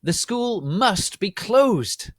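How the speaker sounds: high, loud, slow demand; angry shout. Acted. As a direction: shout it slowly as an order. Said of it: "she shouted angrily".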